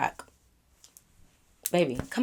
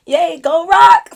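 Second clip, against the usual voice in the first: fake cheer; 8 semitones higher, 7 dB louder, slower than usual.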